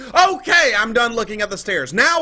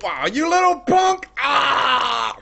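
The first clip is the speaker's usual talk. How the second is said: excited outburst; much slower than usual. Real, not acted. Gamer cursing a block, excited outburst. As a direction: burst out, then cry "Ah!". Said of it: "I get a little excited sometimes".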